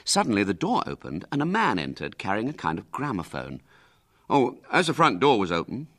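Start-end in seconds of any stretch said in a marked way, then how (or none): 4.3-5.9 louder, higher workman's voice; a friendly tradesman explaining his errand; chatty delivery man. Acted. Delivery man with gramophone talks.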